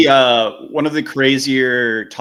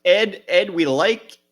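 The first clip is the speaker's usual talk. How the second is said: higher Midwestern cop voice; mock officer; 10 semitones higher than usual. Officer chiding Ed Gein, higher Midwestern voice. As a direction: scold Ed like a polite Midwest cop.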